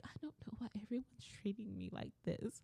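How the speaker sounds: tiny, hushed poor-me voice; small self-pitying murmur; mock pitiful coworker. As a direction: imitate a wounded victim, very softly. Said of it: "then she's gonna be like".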